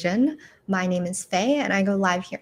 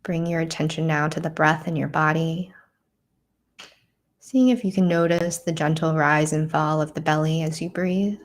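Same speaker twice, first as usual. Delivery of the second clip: calm, measured meditation voice with long pauses; guided meditation. Real, not acted. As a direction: guide them gently inward, leaving space to breathe.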